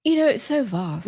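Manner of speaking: lightly put-on British accent, playful; a hint of a grand British accent; mock British accent. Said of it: "I'm doing my vast experiential British accent".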